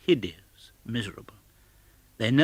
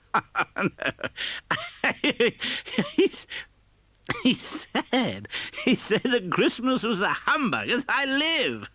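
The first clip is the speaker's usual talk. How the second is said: high voice breaking into laughter; laughing nephew; 13 semitones higher, slower than usual. A narrator's reading. Nephew laughing about his uncle among friends, laughing through words. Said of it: "roared out lustily".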